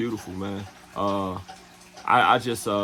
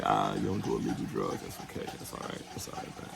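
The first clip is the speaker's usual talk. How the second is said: low, near-whispered "that's all right"; hushed reassurance; 9 dB quieter, faster than usual. Retold or imitated. Imitating the hustler quietly brushing off the refusal. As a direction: soothe him quietly, almost breathless.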